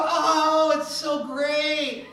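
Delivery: drawn-out, falsely bright brush-off; fake cheerful. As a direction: pretend everything is fine, slowly. Fake answer hiding marriage trouble.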